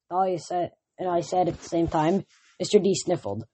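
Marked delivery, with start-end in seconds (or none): none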